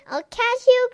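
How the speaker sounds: high, loud chasing call; cried out. Acted. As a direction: cry out as you run after the dog. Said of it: "cried Neelie".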